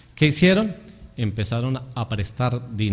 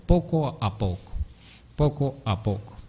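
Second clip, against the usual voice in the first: soft; 7 dB quieter than usual. Shown as a demonstration. Repetition acts out gradual change.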